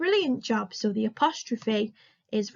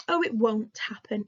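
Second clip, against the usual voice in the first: ordinary talk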